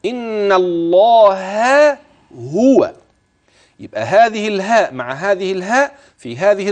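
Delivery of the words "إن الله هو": "إن الله هو" chanted very slowly, with the h of "الله" and the h of "هو" kept distinct; separate the two h's. Shown as a demonstration. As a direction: pull "الله" and "هو" apart without merging the h sounds. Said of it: "وتخلي بالك من الهاء مع الهاء".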